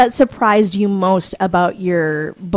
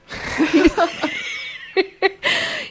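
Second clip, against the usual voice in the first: high-pitched burst of laughter; 10 semitones higher, 4 dB quieter than usual. Real, not acted. Host laughs loudly.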